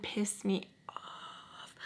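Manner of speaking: slow whispered complaint; hushed resentment. Real, not acted. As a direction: breathe out the grievance.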